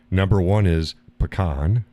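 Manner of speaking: "pecan" as puh-KAHN; pronunciation demo. Shown as a demonstration. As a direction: list "Number one is pecan" with puh-KAHN. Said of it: "How do you pronounce it".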